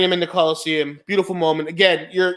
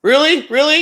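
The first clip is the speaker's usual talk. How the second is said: incredulous; 8 semitones higher, 4 dB louder than usual. Real, not acted. Incredulous at script complaint.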